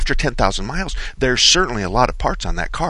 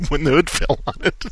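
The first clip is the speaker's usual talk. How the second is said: words spilling out through a laugh; 5 semitones higher, 3 dB quieter than usual. Real, not acted. Host laughing at the absurd claim, words through laughter. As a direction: laugh while you say it.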